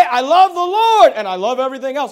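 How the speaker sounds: loud imitation of a professed believer; mock declaration. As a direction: proclaim it loudly as someone else's claim. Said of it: "you can't say".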